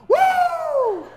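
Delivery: very high, loud "woo"; exuberant whoop. Acted. Pastor playing his caped son running round, gleeful whoop. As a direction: whoop like a kid flying in a cape.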